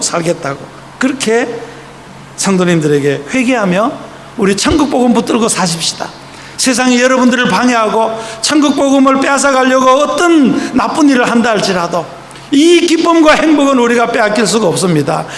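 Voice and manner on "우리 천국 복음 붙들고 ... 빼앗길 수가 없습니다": rising, forceful sermon voice; impassioned pulpit appeal; fervent preaching. Retold or imitated. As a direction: exhort the congregation with growing fervour. Preaching quote to congregation.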